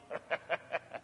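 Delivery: soft, voiceless snicker; a smirking little laugh under the breath. Real, not acted. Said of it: "Kind of a snicker and a smirk".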